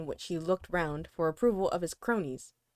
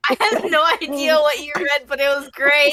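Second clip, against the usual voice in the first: laughing out loud in delight; 7 semitones higher, 12 dB louder than usual. Real, not acted. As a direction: blurt it through big laughs. Laughing after the reading.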